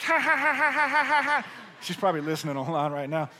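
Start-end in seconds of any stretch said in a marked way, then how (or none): 0.0-1.5 high imitated cackle of a teasing wife; wife mocking his word slip; mocking laugh. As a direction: laugh at him like a tease. Mimicking wife's mocking laugh.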